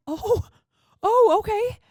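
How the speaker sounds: high-pitched, put-on surprised reaction; mock taken aback. Driver reacting to a missing apology, mock taken aback.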